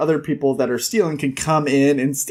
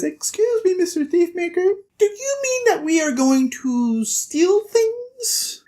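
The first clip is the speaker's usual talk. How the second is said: put-on little boy piping up; 13 semitones higher than usual. Locke questions the Thiefmaker, high little-boy voice. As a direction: pipe up like a small boy with a question. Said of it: "little boy Locke pipes in".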